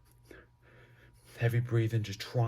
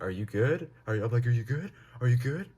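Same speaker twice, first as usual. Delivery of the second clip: louder, rising pitch on each repeat; urgent check-in; 5 semitones higher, 7 dB louder than usual. Retold or imitated. Teller to his zoned-out high friend, urgent and louder. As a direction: press the question, voice climbing at the end.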